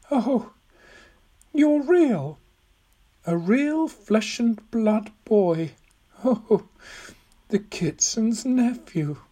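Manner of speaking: put-on high, slow old lady in wonder; old woman, amazed. Old woman finding Tom is flesh and blood, high and amazed. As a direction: marvel in a high, halting voice.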